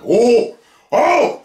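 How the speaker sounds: sharp, high emotional yelp; high cry of "oh". Retold or imitated. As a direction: yelp as if hit in the feelings. Fighting back tears with outcries.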